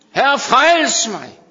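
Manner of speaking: loud, slow cry of Herre, frels mig; preacher crying the plea. Retold or imitated. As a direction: cry out slowly for rescue. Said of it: "plea repeated in preaching".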